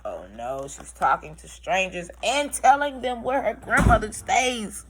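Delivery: usual speaking voice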